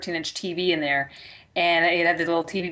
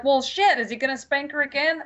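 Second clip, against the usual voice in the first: eager suspense; 6 semitones higher than usual. Retold or imitated. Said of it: "You're like".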